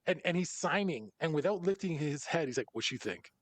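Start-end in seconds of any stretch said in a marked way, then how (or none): 2.7-3.3 low, soft, unbothered mumble; dry dismissive. Retold or imitated. Rapper answers a fan with head down, low and dismissive. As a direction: brush the question off without looking up.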